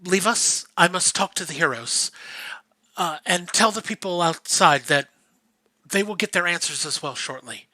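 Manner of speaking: lightly voiced, spent and resolute; weary priestess. Seiko, just awoken, sending Akano away, soft and tired.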